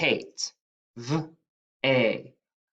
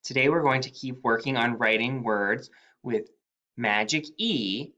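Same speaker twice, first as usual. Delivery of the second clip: usual speaking voice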